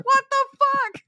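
very high, loud startled cry; a knife in the leg, shocked; mock shocked yelp. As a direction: squeal it like you just got stabbed.

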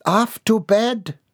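put-on high, drawn-out order to a child. A narrator's reading. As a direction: send them to bed, slowly and high. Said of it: "Missus Peabody ordered".

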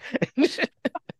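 high burst of laughter. Real, not acted. Laughing about the city not being so bad. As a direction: laugh at the joke.

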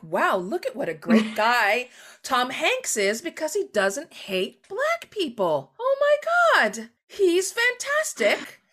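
high, loud, slow fawning praise; mock gushing. Mocking how society praises Tom Hanks, high gushing. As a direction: gush like a starstruck fan. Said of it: "we're like".

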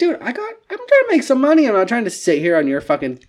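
high, loud, mock-exasperated rant. Scolding a customer who won't answer, in a high exasperated rant. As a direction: vent at the customer who won't open the gate. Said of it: "And I'm like".

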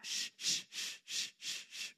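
soft rhythmic shushes; short, repeated breathy hushes; calming shush. Shown as a demonstration. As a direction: demonstrate hushing a baby. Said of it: "would calm".

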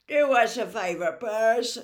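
said through a yawn; lazy yawning brother. A narrator's reading.

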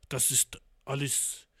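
put-on slow Russian accent in German; self-admitted weak Russian accent. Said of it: "ich kann so keinen russischen Akzent".

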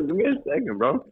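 talking through a mouthful; mouth full. Real, not acted. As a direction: speak around a bite of food. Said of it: "I got food in my mouth".

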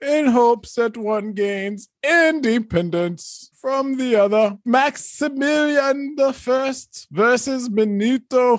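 high, slow herald proclamation; town crier. Acted. Opens negotiation as herald.

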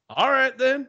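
high, imitated movie sheriff; sheriff impression. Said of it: "And he goes".

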